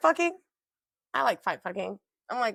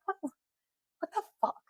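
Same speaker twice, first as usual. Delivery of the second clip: disbelief; 8 semitones higher, 6 dB quieter, slower than usual. Retold or imitated. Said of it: "I'm like".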